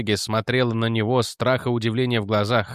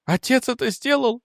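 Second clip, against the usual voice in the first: much higher, stunned outcry; shocked cry; 12 semitones higher than usual. A narrator's reading. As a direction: gasp it out in horror. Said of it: "вскрикнула она".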